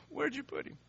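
high, tearful question; deeply moved. Acted. Jesus, deeply moved, asks mourners where the body is, tearfully. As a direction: ask it as if overcome by grief. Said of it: "Again, so emotional".

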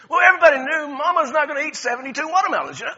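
high, loud, fast laughing telling; amused storyteller getting loud; excited punchline. Real, not acted. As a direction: burst out with the joke.

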